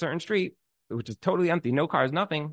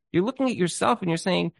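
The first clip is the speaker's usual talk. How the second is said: usual speaking voice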